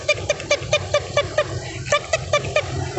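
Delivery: high whispered ticking clicks; imitating clicking. Rider mimicking the cable car's clicking, whispered ticks. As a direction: tick it out softly like the cable wheel. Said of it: "Ele vai assim, tic".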